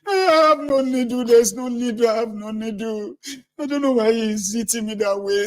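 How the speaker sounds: high, whiny denial; high whiny voice of a fibbing boy; innocent little boy. Retold or imitated. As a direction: play the sly kid pretending innocence. Cunning boy plays innocent.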